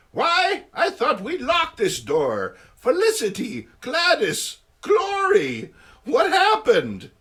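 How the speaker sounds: put-on high, loud fussing old lady; flustered old aunt. Aunt May finding the women, high and flustered. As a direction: fluster like a startled auntie.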